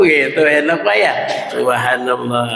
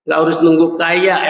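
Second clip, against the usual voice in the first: ordinary talk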